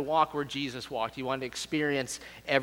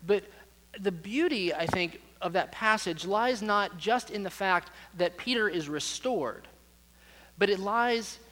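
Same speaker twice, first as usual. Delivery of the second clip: normal talking voice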